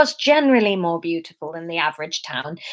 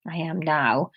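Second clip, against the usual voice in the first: soft, sheepish; quiet admission; 4 semitones lower, 6 dB quieter than usual. Acted. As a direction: admit it under your breath. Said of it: "she admitted".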